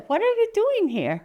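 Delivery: high, loud demand; challenging bird. Acted. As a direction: question the intruder, high and loud.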